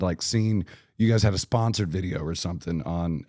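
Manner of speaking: normal talking voice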